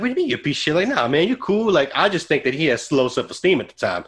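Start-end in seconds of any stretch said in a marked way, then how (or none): 0.0-0.7 much higher, incredulous question; stunned, pitch jumps up in disbelief; shocked disbelief. Retold or imitated. Retold shocked reply to friend. 0.9-1.7 high reassurance. Retold or imitated. Reassuring friend after confession.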